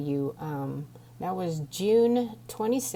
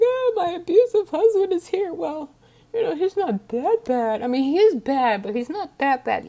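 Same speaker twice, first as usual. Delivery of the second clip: high, loud, sugary mock-polite voice; mimicking herself excusing her abusive husband to the dispatcher, high and loud; too-nice victim; 10 semitones higher, 9 dB louder than usual. Voice pitched way up and loud, gushing. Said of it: "they're also nice".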